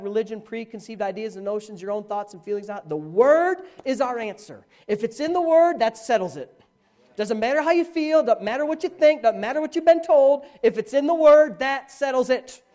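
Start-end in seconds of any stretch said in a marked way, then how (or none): 2.9-12.6 loud, raised, insistent declaration; emphatic preaching. Real, not acted. Preacher telling congregation the Word decides, forceful insistent preaching. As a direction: drive each clause home with force. Preacher drives home his point.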